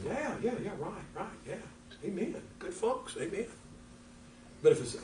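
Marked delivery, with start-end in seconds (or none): 0.0-3.5 lightly voiced, softer, higher agreeing; eager agreeing listener. A church member listening to good talk on the phone, lightly voiced eager agreement. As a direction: nod along with a chuckle.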